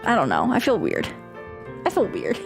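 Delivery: ordinary talk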